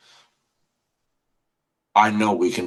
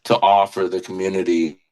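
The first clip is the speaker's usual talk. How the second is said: normal talking voice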